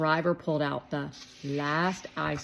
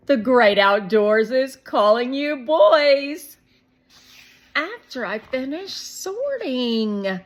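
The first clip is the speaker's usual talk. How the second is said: higher, slow coaxing voice; story voices; 8 semitones higher, 11 dB louder, slower than usual. A narrator's reading. Mom coaxing the boys outside, high slow coaxing. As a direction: lift it up and draw it out.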